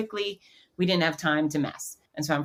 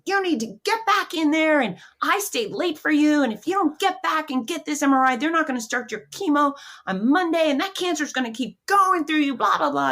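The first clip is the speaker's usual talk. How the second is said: high, loud mock scolding; hammed-up furious rant, pitched way up; angry technician; 11 semitones higher, 5 dB louder than usual. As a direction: imitate an irate worker telling off a patient. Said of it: "now she's angry".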